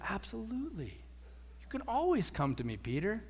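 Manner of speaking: softly welcoming, voice dropping; gentle reassurance. Acted. Jesus welcoming Peter to come to him, softly reassuring. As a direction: reassure him quietly and tenderly.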